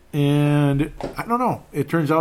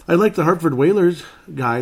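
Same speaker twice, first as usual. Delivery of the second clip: bright, louder burst of enthusiasm; excited; 6 semitones higher, 7 dB louder than usual. Real, not acted. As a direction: really sell how much you like him. Enthusiastic about fan in crowd.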